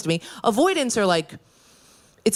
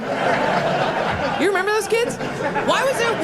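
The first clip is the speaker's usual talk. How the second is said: incredulous; 11 semitones higher, much slower than usual. Real, not acted. Incredulous slow question.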